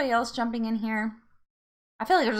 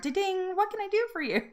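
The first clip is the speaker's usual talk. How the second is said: lightly voiced chirpy phone assistant, pitched higher; a playful hint of a smart-speaker reply; Siri voice; 10 semitones higher than usual. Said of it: "then I hear Siri in the background".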